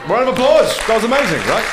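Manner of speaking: big, high, loud cheer of praise; delighted applause call; excited praise. Real, not acted. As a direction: celebrate the student out loud. Coach cheers student success.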